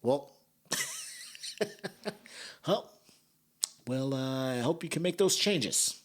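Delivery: mock condescending judge; looking down. Judge looking down on an old schoolmate, mock condescension. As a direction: act out looking down on someone, chuckling. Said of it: "they're looking down on me like".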